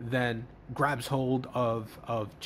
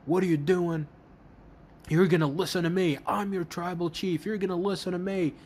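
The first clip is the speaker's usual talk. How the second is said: higher, insistent mock tirade; imitated yelling; 6 semitones higher, faster than usual. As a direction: lay down the law, pitched up. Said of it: "Roman starts yelling at Solo".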